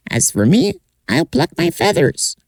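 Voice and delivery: very high, tiny bird voice; little bird voice. Acted. Little bird asking the oak tree, very high voice. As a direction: chirp it out like a small bird.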